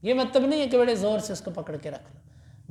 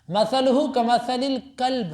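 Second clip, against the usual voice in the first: Quran recitation; 4 semitones higher, 3 dB louder, much slower than usual. Acted. Quran verse recited in lesson.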